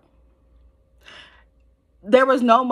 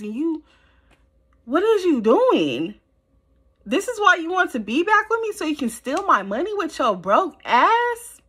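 heated ranting; 9 semitones higher than usual. Real, not acted. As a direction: lay into him with real outrage. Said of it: "He had me hot".

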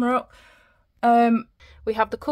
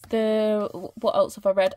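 ordinary talk, nothing marked

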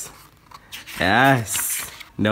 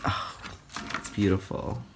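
ordinary delivery

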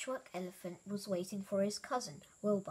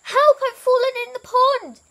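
very high, loud cry; crying for help; 17 dB louder than usual. A narrator's reading. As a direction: shout out in distress. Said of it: "cried the voice".

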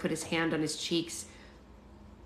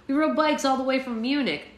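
put-on mildly let-down, in the reader's own accent; a touch of disappointment, higher pitched; lightly acted complaint; dejected; 7 semitones higher, 5 dB louder than usual. A narrator's reading. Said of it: "Connor said dejectedly".

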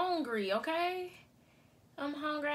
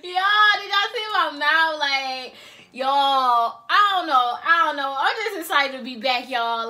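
loud giggly; 10 dB louder, slower than usual. Real, not acted. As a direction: talk through leftover laughter. Laughing after thumbnail.

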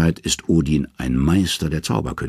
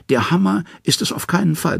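high, wheedling trickster voice; pitched-up scheming god; sly Loki; 12 semitones higher than usual. A narrator's reading.

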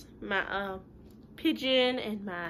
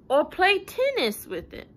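pigeon voice; 7 semitones higher, 6 dB louder than usual. Acted.

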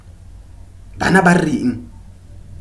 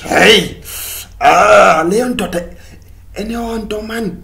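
ordinary talk

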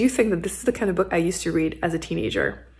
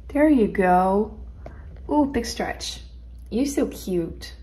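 slow tender coo; much slower than usual. Real, not acted. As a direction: coo gently at the stretching kitten. Cooing to stretching kitten.

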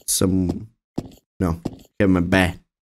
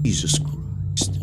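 shocked whisper; 4 semitones lower, 6 dB quieter, much slower than usual. Real, not acted. Shocked in horror game.

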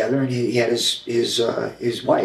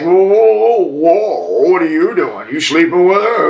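mocking taunt; 6 semitones higher, 5 dB louder, slower than usual. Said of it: "they were like".

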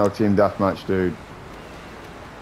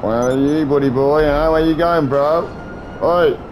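raised, higher calling taunt; 6 semitones higher, 4 dB louder, slower than usual. Real, not acted. Hunting a hidden player, loud taunting call. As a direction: call out to flush the enemy.

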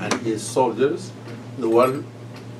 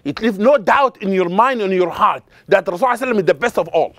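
normal talking voice

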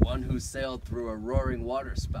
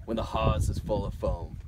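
sea ballad read with a lilt; poem recitation; 6 dB louder than usual. Acted. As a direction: recite it like an old sailor's ballad.

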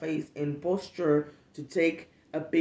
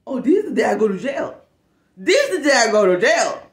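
louder, building outraged declaration; rising fury; 4 semitones higher, 9 dB louder than usual. Real, not acted. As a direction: say it like you're about to snap. Furious at being photographed.

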